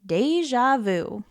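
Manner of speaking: ordinary delivery, nothing marked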